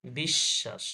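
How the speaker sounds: বিশ্বাস said bish-shash; doubled শ. Shown as a demonstration. Teacher shows students the doubling, বিশ্বাস as bish-shash. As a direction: lengthen the middle sh in বিশ্বাস. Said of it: "double হয়ে গেছে".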